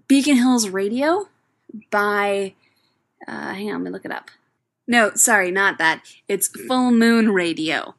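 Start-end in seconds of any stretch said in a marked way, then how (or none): none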